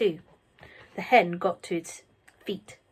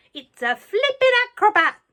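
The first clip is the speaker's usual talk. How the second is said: shouted; 13 semitones higher, 9 dB louder than usual. A narrator's reading. Grandma shouting from the rooftop, delighted. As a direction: holler it from the rooftop. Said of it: "Grandma shouted".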